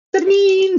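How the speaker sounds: high sharp alarm "trin"; imitated alarm ring. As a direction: mimic the alarm clock going off. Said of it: "él puso el despertador".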